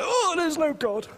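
high, slow exasperated cry; hammed-up high despairing voice; mock doubter. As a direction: whine it out like a let-down believer. Preacher voicing doubting believers.

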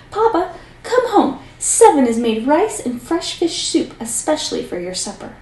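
high shrill-pitched calling to father; the oldest daughter screeches for Papa to come home; shrieking. Acted. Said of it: "she shrieked".

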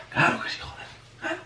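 whispered, bitter "claro que sí"; sarcastic whisper. Real, not acted. Whispering sarcastically to viewers about the coach's substitution. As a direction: breathe it out in scornful agreement.

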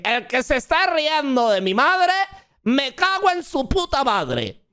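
cita de Garza algo más aguda, enfadada en broma; imitando a Garza. Retold or imitated. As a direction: imita a Garza soltando la amenaza, medio en broma. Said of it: "cuando llega Garza dice". (Garza enfadado, imitado a la ligera)

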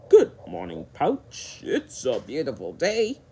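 casual greeting with a hint of a crocodile voice; storybook crocodile's easy good morning; lightly voiced casual crocodile. A narrator's reading. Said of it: "said Swampy in a casual way".

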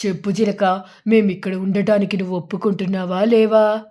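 low-voiced eagle demanding an answer; eagle character. Eagle asks parrot whether they may stay, low character voice.

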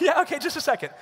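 high, mock-nervous stalling; imitating a scared first-time jumper. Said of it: "I was so nervous".

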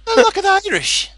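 high lilting Irish voice; hammed-up Irish, very high; Irish accent. Shown as a demonstration. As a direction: keep up the squeaky Irish lilt. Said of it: "Irish is light and lilting".

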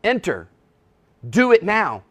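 the preacher voicing Jesus' urgent call; firm command. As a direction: bark the order, no room to refuse. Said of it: "he phrases it as a command".

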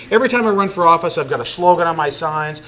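ordinary talk

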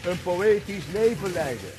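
declaimed poem, slowing and sinking at the close; poem recital. Acted. Poet reciting Anwari to the audience, declaimed. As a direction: recite it like a Sufi love poem.

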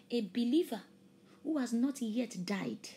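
loud, fervent preaching that stretches key words; impassioned sermon climax, drawn out and loud. Acted. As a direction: preach it with full force to the congregation. Preaching at full force again.

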